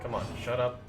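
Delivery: ordinary talk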